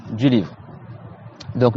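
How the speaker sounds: usual speaking voice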